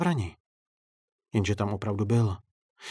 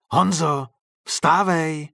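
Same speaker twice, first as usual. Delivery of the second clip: raised, higher wake-up call; insistent loud call from behind a door; louder call; 5 semitones higher, 6 dB louder, much slower than usual. A narrator's reading. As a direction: call louder to rouse the sleeper. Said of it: "zopakoval ten někdo hlasitěji".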